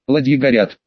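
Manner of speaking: ordinary delivery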